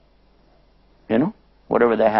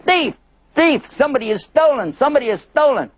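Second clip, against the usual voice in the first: hollered alarm; 11 semitones higher than usual. Acted. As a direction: shout thief at the gate. Somebody hollered.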